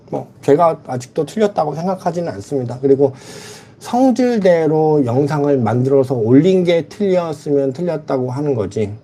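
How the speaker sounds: ordinary talk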